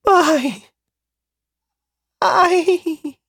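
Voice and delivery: high, panicked stammer; babbling in fear. A narrator's reading. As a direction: stammer it out terrified. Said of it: "Arthur babbled".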